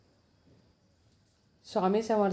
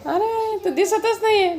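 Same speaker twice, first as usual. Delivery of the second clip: laughing; 11 semitones higher, 4 dB louder than usual. Real, not acted. Parent laughs, child invisible dark.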